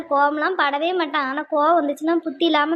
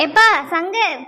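raised-voice call to a son; 4 dB louder, slower than usual. Acted. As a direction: call him over, louder at the start. Calls the son over.

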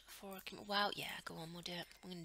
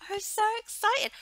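much higher, loud excitement; excited squeal; 13 dB louder than usual. Real, not acted. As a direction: squeal with joy.

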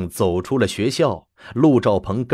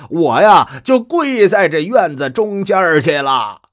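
put-on old man pleading; 7 semitones higher, 6 dB louder than usual. Kneeling grandfather begs stubbornly.

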